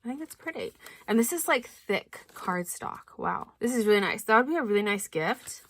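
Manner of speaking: ordinary delivery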